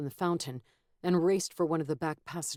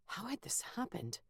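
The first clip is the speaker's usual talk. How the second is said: whispering; 5 semitones higher, 11 dB quieter than usual. A narrator's reading. Inner thought whispered.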